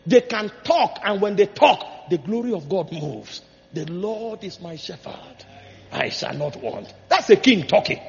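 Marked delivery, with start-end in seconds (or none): 3.7-6.9 slow and soft, much quieter, savoring each word; David humbly confessing trust to God, softly; hushed reverent recitation. Pretend. Preacher voicing David's psalm to God, slow and hushed.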